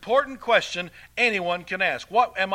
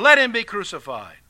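loud, raised cry of a mob; crowd crying out; 5 semitones higher, 11 dB louder than usual. Acted. The crowd answers Pilate, loud acted outcry. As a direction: call it out over a crowd. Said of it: "they cried out the more".

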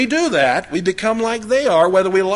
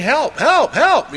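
high calls of help, help; mock yell for help; 8 semitones higher, much slower than usual. Man stuck in a hole yelling for help. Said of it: "He starts yelling".

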